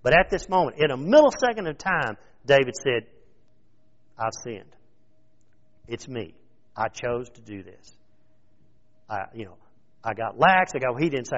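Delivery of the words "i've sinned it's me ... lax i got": humble confession. Acted. David confessing quietly.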